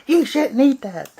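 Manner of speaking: high, loud imitation of a telling-off; hammed-up, high-pitched scolding; mock scolding yell. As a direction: yell it like a scandalized nag. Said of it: "you're gonna yell at us".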